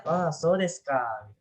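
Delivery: "Ah, so desu ka" drawn out, ending downward; falling intonation. Shown as a demonstration. Teacher reading the dialogue's last line, "so desu ka" falling slowly. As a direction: let "ka" sink in a slow "so desu ka". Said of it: "Turun ya?".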